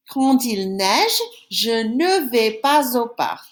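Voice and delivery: slow, carefully spaced French; teacher rereading the sentence for the class to repeat; slow repeat-after-me. Shown as a demonstration. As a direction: read it slowly for pupils to echo. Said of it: "I'm gonna read it one more time, then you say it after me".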